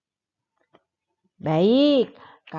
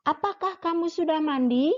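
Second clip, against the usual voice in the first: "Apakah kamu sudah mandi?" asked quickly, pitch climbing to the end; rising question intonation; 8 semitones higher, faster than usual. Shown as a demonstration. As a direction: ask it brightly and let the voice go up on "mandi". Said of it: "yang menggunakan intonasi naik". (intonasi naik di akhir, "mandi" diucapkan meninggi)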